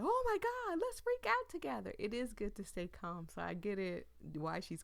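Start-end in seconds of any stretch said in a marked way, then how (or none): 0.0-1.9 high, loud mock panic; mock freak-out. Mocking a panicking partner, high squealing freak-out. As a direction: squeal it like you're panicking together. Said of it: "It's not good to be like".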